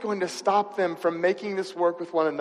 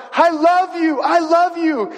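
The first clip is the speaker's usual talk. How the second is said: high, loud joyful shout down the aisle; shouted love; 10 semitones higher, 6 dB louder than usual. Retold or imitated. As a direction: bride calls out to the groom, high and loud. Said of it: "and shouts".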